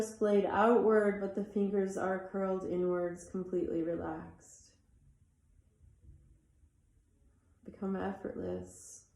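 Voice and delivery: soft, unhurried meditation voice with long pauses; guided meditation. Acted. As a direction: ease them into stillness, letting each phrase settle.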